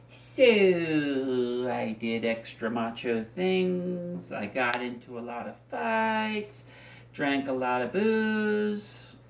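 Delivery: very slow, drawn-out vowels; mock-macho drawl. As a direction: stretch out each last word with swagger. Monologue, lists extra macho things.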